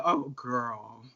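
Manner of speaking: a hint of a soothing older queen; lightly voiced sympathetic queen. Drag queen imitating the queen who comforted her, low soothing "Oh, girl". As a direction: say it low and knowing. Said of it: "That's how she said".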